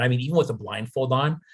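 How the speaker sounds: usual speaking voice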